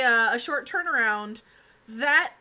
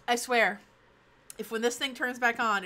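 normal talking voice

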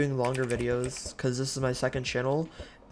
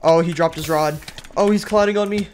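panicked; 6 semitones higher, 7 dB louder than usual. Real, not acted. Opponent outplays him.

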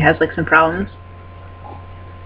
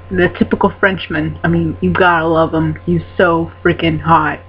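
usual speaking voice

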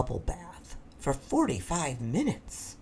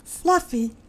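small voice. Acted. Fluffy answers the rhino.

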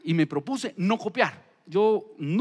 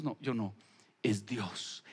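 humble reply; 9 semitones lower, 10 dB quieter, slower than usual. Retold or imitated. Daniel's humble answer voiced.

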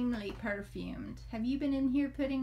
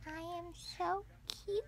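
put-on baby voice; 3 semitones higher, 7 dB quieter than usual.

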